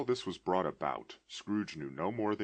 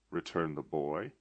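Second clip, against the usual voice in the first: ordinary delivery, nothing marked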